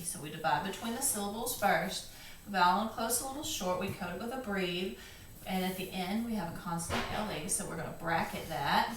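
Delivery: normal talking voice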